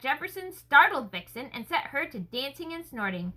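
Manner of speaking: ordinary delivery, nothing marked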